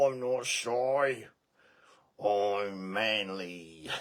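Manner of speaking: slow, loud puffed-up manly voice; mock manly pet voice. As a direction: play the pet pretending to be tough.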